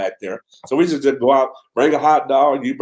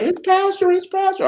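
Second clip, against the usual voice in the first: much higher, sing-song "it's pastor"; cheery greeting call. Retold or imitated. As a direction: hail the families from across the lot. Calling out while waving from afar.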